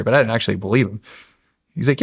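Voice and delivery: normal talking voice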